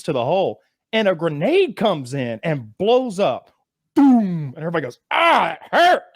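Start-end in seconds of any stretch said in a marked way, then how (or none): 3.9-4.5 boom. Retold or imitated. Retold grenade blast. 5.1-6.0 high, louder mock cry of pain; imitating a hurt soldier's cry. Soldiers hit by grenade, retold.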